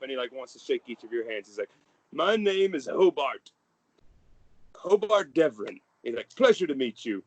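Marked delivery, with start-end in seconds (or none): 2.1-7.2 high, merry fatherly gush; jolly father. Acted. The merry father welcoming his son home, high and gushing. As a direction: beam over the boy like a proud dad.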